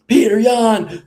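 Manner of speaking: high, loud callout of a rival's name; imitated post-fight shout; mock fighter callout. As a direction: call out your rival after a win.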